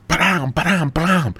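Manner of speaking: high, punchy vocal trumpet fanfare; mock fanfare. God announcing his new prophet, high mock fanfare. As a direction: sound out a royal fanfare with your voice.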